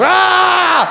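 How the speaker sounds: very high, loud laughing shriek; a high, loud yelp of surprise from the vendor; shocked shriek. Retold or imitated. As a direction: let out a startled yelp. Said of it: "He's like".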